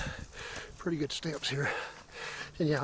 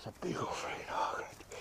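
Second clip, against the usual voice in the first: whispering; 11 dB quieter than usual. Real, not acted. Spotting a hog on trail.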